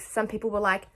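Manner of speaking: ordinary delivery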